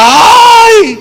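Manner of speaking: high, hammed-up fake cry; a sudden fake wail of grief; mock mourner wail. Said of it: "Y empieza". (gemido fingido, agudo y exagerado)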